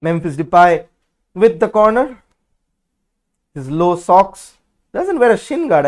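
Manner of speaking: ordinary talk, nothing marked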